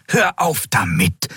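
understated telling-off; scolding. A narrator's reading. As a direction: chide him sternly. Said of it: "tadelte Xea ihn".

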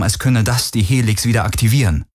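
usual speaking voice

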